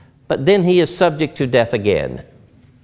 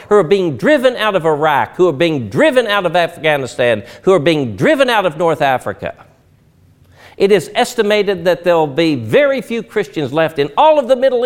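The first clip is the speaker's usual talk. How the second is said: higher, louder preaching that builds; rally the congregation with rising fervor; preacher lifting the church's voice; preaching at full force; 7 semitones higher, 4 dB louder than usual. Acted.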